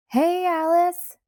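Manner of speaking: shouting over loud music. A narrator's reading. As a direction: call out loud and drawn out. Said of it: "I shouted over the wailing".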